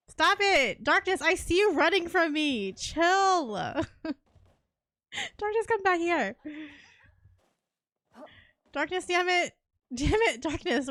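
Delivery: high, loud yells, half laughing; frantic yelling. Real, not acted. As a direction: shout after him as he runs off. Chasing teammate, yelling after him.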